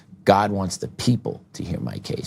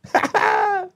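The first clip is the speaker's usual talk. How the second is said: high laugh. Real, not acted. Laugh after remark.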